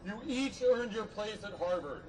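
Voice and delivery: ordinary talk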